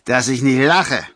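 tipsy scoff landing firmly on LACHE; self-corrected scoff. A narrator's reading. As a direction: correct the slip with a two-syllable LACHE. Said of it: "verbesserte sich sogleich".

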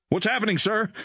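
put-on high-pitched tense young crewman; strained, tense. A narrator's reading. Crewman asks his pilot what's wrong, strained by tension. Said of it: "a voice strained by obvious tension".

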